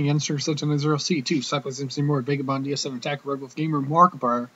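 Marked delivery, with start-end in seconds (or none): none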